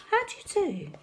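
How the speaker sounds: high polite duck greeting. A narrator's reading. Duck politely greeting the highway rat, high. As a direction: sing it up high like a prim duck.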